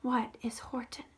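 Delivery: worried whisper; hushed and anxious. Real, not acted. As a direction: whisper it like a secret fear. Worried aside to child.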